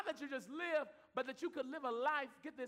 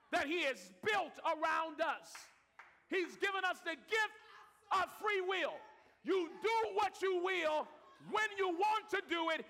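louder, higher, shouted sermon; preaching at full force; 7 semitones higher, 5 dB louder than usual. Real, not acted. Preacher rising to a shout over the congregation. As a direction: drive it home to the church.

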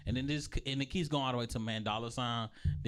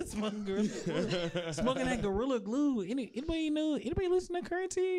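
high, giggly stoned voice; stoner voice; 10 semitones higher than usual. Pretend. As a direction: act baked and giddy. Acting high on Gorilla Glue.

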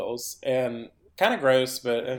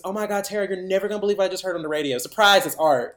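fast, high rush of news; excited gossip; 7 semitones higher, 6 dB louder, faster than usual. Retold or imitated. Friend bursting in to tell Tara the news, fast and high. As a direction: blurt it all out in one breath. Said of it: "She goes".

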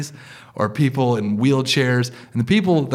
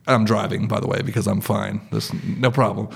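ordinary talk, nothing marked

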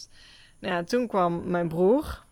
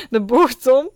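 loud high laugh, then louder; 5 semitones higher, 12 dB louder than usual. Real, not acted. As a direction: burst out laughing at it. Laughing at mother vanishing.

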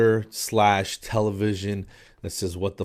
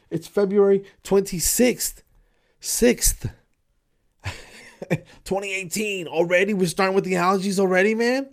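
incredulous; 9 semitones higher than usual. Real, not acted. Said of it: "It's unbelievable".

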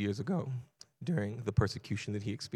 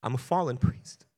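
shocked protest; 3 semitones higher, 6 dB louder than usual. Retold or imitated. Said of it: "in shock and says".

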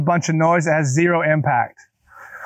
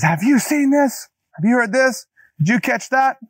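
high, breathless mock excitement, clearly raised pitch; eager gossip quote; 7 semitones higher than usual. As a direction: play the hyped-up friend pushing news on you. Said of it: "somebody says".

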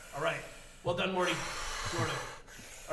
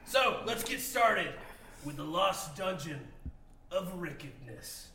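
dramatic title read; 2 semitones lower, slower than usual. Acted. Ominous dungeon title.

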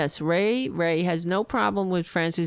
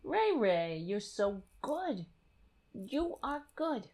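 much softer, slower coo; cooing to the cat; 4 semitones higher, 8 dB quieter, slower than usual. Real, not acted. Cooing tenderly at the cat. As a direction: melt over the cat tenderly.

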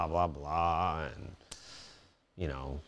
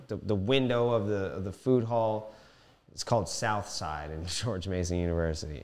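ordinary talk

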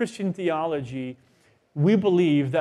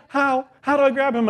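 panicked wife; 9 semitones higher, 5 dB louder than usual. Retold or imitated. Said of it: "She goes".

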